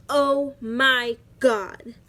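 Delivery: loud, drawn-out shocked exclamation; stretched-out mock shock; scared surprise. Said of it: "so scared, so surprised".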